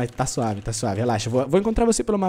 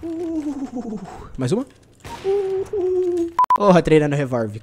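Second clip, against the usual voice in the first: excited whoop; 8 semitones higher, much slower than usual. Real, not acted. Streamer cheering his pistol kills. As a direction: whoop after each kill.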